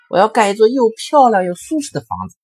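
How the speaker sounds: high, loud piggy boast; boastful second pig. Acted. Second pig bragging to his younger brother, high and loud. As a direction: brag it up in a little pig voice.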